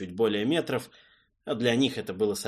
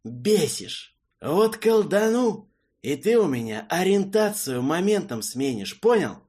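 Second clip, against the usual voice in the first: angry threat; 8 semitones higher, 6 dB louder than usual. Acted. Mage threatens the messenger.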